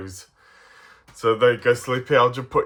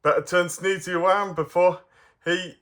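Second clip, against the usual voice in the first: usual speaking voice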